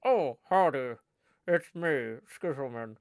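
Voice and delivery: lightly voiced puppet pal; Skizzleman. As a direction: answer as the second puppet. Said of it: "Joel and Skizzleman".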